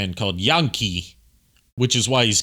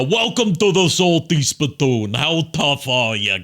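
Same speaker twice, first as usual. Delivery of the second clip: slow, higher tough-guy growl; Salty Spittoon bouncer voice; 5 semitones higher, 3 dB louder than usual. Retold or imitated. Guy recalling his audition voice, doing the SpongeBob tough guy. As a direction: puff up like the cartoon bouncer. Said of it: "the voice I went was basically the".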